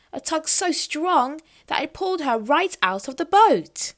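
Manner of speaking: plain reading voice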